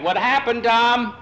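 high, loud gloating call back; high excited teasing shout; taunting call. Acted. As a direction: crow over your shoulder at the loser. Taunting rival while winning.

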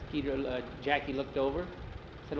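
ordinary delivery, nothing marked

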